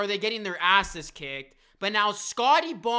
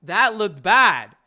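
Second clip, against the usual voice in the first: louder, slow, heavy stress on "bad"; loud dismayed verdict; 9 dB louder, slower than usual. Real, not acted. Replay of injury shocks him.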